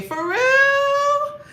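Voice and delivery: high, loud surprised squeal. Real, not acted. To a viewer, a high surprised squeal. Surprised reply to look-alike comment.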